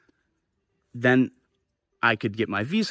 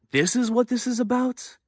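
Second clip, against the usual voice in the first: high-pitched incredulous question; reenacted disbelief; 11 semitones higher than usual. Reacting to the racism accusation, high incredulous voice.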